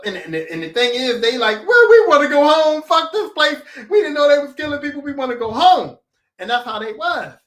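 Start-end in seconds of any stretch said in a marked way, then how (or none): 1.6-6.0 raised, louder demanding voice; loud protest. Retold or imitated. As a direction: demand it like scared players. Players demanding to leave.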